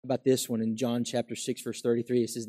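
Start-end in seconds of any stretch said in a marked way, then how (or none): none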